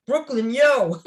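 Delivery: Brooklyn greeting. Retold or imitated. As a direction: hail a fellow Brooklynite at full voice. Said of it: "So we greet each other".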